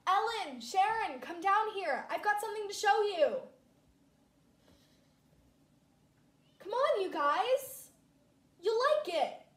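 loud, high call down to her friends. Acted. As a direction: shout up the stairs, eager to show off. Calling friends down to show.

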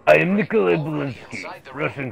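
Russian cosmonaut character voice; hammy zombie-fighter impression, starting high; Nikolai Belinsky. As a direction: announce yourself as the game's Russian soldier.

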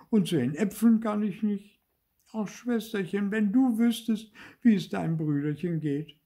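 high-pitched self-pitying puppet; whimpering. A narrator's reading. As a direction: whimper to yourself in a high little voice. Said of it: "Zäpfelkern wimmerte vor sich hin". (hoch und wimmernd)

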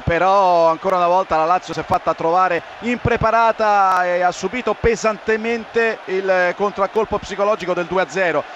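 ordinary delivery

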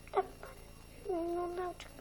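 soft whispered reply. Acted. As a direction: breathe it so the teacher can't hear. Pupil answers under breath.

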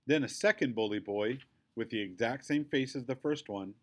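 plain reading voice

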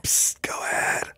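whispering. Acted. Joins the whispering game.

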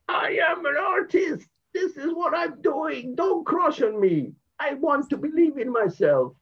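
high, loud anguished cry. Retold or imitated. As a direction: cry it out to the world. Shouting his flag's message.

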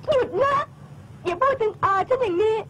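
high, tearful outburst; upset protest. Acted. Girl hurt as her friend mocks her aunt, upset.

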